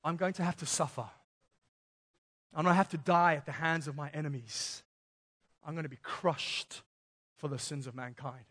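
low, soft, solemn foretelling; quiet and grave. Retold or imitated. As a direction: say it softly, heavy with what's coming. Jesus foretells his suffering.

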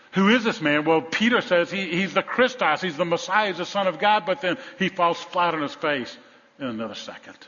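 high, loud, emphatic proclamation; full-force preaching. Real, not acted. Preacher proclaims Peter's answer to the church, full-force preaching. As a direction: drive each declaration home.

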